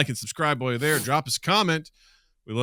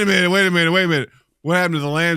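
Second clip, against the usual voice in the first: louder, higher wait a minute; mock alarm; 7 semitones higher, 10 dB louder, much faster than usual. Dad stops daughter's song, loud mock alarm. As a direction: stop her short in fake alarm.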